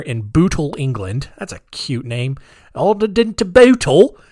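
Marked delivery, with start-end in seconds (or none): 2.7-4.2 higher, louder put-on British accent; hammy attempt at a British accent; British accent, a bit shaky on authenticity. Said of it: "I wanna do British accent".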